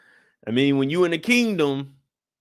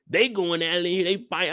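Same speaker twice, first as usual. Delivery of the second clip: ordinary talk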